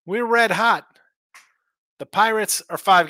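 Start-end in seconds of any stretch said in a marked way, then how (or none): 0.1-0.8 bragging cheer, pitched way up and louder; hammed-up boast about a hot streak; mock hype. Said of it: "I'm running and be like".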